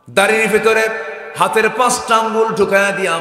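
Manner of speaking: loud, high chant easing down at the end; full-force preaching. Acted. Father telling how his daughter cleaned his beard, preaching chant. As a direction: open high and ringing, then let it descend.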